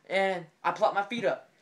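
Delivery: plain reading voice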